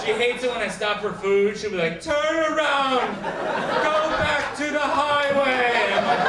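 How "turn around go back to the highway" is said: slow, high, loud mock command; overbearing navigator. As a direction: bellow each word like a bossy sat-nav. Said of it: "say it in that way".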